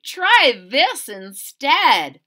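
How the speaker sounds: Lily offering her breakfast; bossy girl. Acted. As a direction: show them a better way. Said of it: "said Lily".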